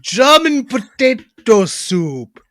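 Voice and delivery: slow, hammed-up German accent. Shown as a demonstration. Speaker nails it at last, slow German accent. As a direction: draw out each word in mock German. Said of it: "There it is".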